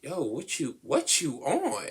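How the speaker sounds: high, slow incredulous yo; mock outrage at being ordered; teen scoffing back, high; indignant kid. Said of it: "you just be like".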